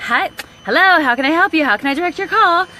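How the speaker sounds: brighter, chirpy phone greeting; lightly voiced receptionist. Her old office self answering calls, chirpy. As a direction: answer the phone like the front desk. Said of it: "me years ago working in office".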